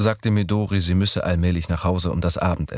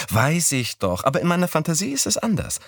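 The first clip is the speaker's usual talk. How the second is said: put-on higher young woman's voice; Midori's voice; 10 semitones higher than usual. A narrator's reading. Narrator voicing Midori proposing Sunday, higher voice. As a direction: pitch up for the girl.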